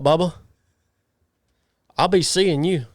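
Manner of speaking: ordinary talk, nothing marked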